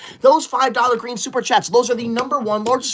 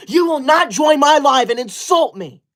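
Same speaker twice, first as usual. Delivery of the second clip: higher, huffy telling-off; indignant scolding; 5 semitones higher than usual. Acted. Streamer to insulting viewer, indignant scolding. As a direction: lay down the law, offended.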